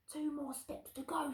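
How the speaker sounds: high, hushed crook voice; soft, high storybook thief; sneaking thief. Acted. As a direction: hiss directions like a burglar. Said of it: "said Hugh".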